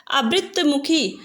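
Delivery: ordinary delivery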